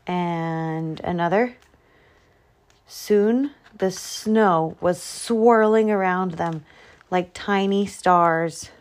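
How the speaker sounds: usual speaking voice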